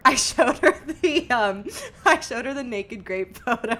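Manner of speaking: loud, high, laughing through it; laughing confession. Real, not acted. Confessing which photo grandma was shown, laughing hard. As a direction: drag it out, cracking up as you go. Joking confession, both cracking up.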